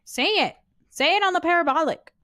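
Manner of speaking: high, loud, insistent order; loud demand. Real, not acted. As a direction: burst out demanding an answer. Demands the silent ghost speak.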